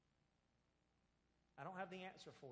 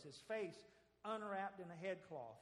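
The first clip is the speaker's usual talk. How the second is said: ordinary talk, nothing marked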